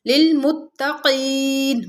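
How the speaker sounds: लिल मुत्तकीन slow, the त्त of मुत्तकीन doubled. Shown as a demonstration. Teacher demonstrating the doubled ta in मुत्तकीन. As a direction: stress the doubled ta slowly. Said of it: "मुशद्दद हर्फ को दो दफ़ा पढ़ा जाता है".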